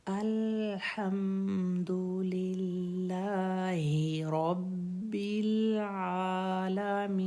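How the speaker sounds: slow chanted verse, each letter clear; slow tajweed recitation. Shown as a demonstration. Teacher reciting the full verse again for practice. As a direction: recite the whole line again, slowly. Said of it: "फिर से".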